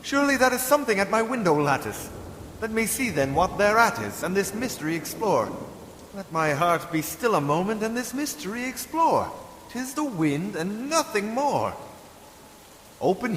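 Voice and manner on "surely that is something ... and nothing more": nervous exclaim. Acted. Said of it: "said I".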